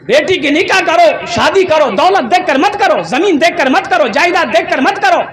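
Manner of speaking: high, ringing exhortation; insistent sermon refrain, swooping pitch; impassioned preaching. Acted. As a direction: drive each command home to the congregation. Preaches at full force.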